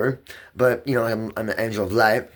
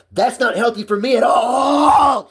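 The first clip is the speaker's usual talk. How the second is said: much higher, much louder and slow, sobbing it out; crying shout; 12 semitones higher, 6 dB louder, slower than usual. Real, not acted. Man breaking down about his medication, crying shout. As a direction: voice cracking into a crying yell.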